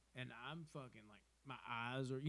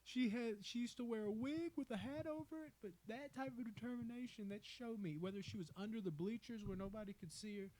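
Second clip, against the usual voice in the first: much higher, put-on voice retelling a heartfelt speech; imitating Greg Olson; 8 semitones higher than usual. Retelling Greg Olson's speech about his mom in a much higher put-on voice. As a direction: mimic Greg Olson going high and earnest. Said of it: "fucking Greg Olson's like".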